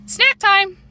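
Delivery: high, carrying call. A narrator's reading. Mother announces food to the girls, calling out. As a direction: call it through a closed door. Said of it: "knocked on my door and called".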